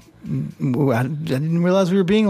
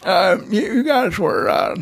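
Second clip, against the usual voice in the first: put-on old grandpa voice; 3 semitones higher than usual. Grandpa character.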